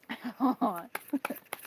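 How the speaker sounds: soft real giggle; laughing. Real, not acted. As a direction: laugh quietly to yourself. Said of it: "I'm laughing because".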